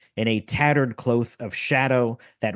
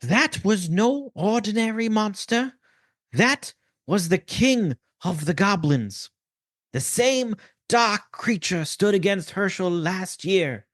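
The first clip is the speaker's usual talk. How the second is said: rally them softly, full of fire; quiet but fiery rabbi; 8 semitones higher than usual. Acted. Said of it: "Quiet voice, but full of fire. Think female Dumbledore".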